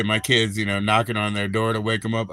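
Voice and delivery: normal talking voice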